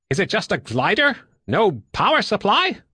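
high, incredulous questions; puzzled questioning. Acted. Woodbine walking round the craft, high and puzzled. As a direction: query it with sharply lifted pitch.

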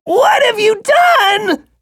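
very high, slow furious shout; worm demanding. A narrator's reading. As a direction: demand an answer as the wounded worm. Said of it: "it demanded".

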